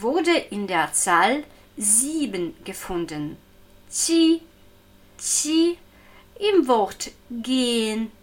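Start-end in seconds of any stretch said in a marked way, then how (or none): 3.9-5.8 "Qi" on a high level tone, soft ch. Shown as a demonstration. Teacher showing the sound in number seven, "Qi" high with soft ch. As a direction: hold "Qi" high and even. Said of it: "ein weiches Ch".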